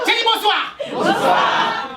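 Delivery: high, loud demanding repeat; mock stern shout. Drama teacher insisting the audience greets him, loud. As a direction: bark it at the class.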